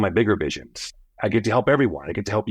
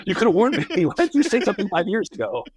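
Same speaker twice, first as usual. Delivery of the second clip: laughing through the words, higher; 10 semitones higher, faster than usual. Real, not acted. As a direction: laugh as you throw the joke back. Echoes host's joke while laughing.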